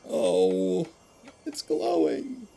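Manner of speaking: overwhelmed by the game, voice climbing high; moved nearly to tears, pitch soaring; tearing up. Real, not acted. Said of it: "Why am I tearing up over monsters?".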